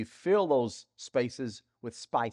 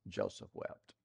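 hushed solemn; 6 semitones lower, 15 dB quieter than usual. Real, not acted. Dwelling on Joseph's tears.

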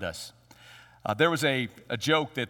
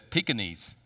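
lower, softer timid reply; very nervously; 7 semitones lower, 6 dB quieter than usual. Retold or imitated. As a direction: shrink back, barely daring to say it. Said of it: "very nervously".

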